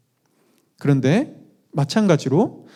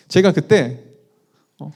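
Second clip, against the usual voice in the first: normal talking voice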